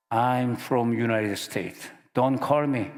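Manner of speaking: slow, deliberate American English. Retold or imitated. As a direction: stall the caller in English. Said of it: "막 영어로 그냥".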